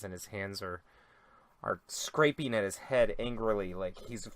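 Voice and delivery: ordinary talk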